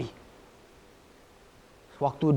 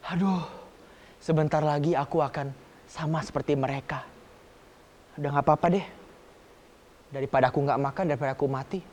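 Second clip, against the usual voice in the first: resigned son; 4 semitones lower, 6 dB quieter, faster than usual. Acted. Son resigned before father's house.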